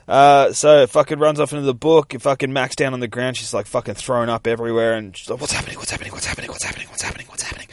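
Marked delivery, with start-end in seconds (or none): 5.4-7.7 rapid, repeated whisper; shocked whispering. Acted. Girl thrown up on the ground, frantic whisper. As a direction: whisper it over and over in a daze.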